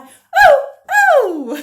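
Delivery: very high, loud monkey hoots; monkey whoops. Pretend. Monkey sounds in action song.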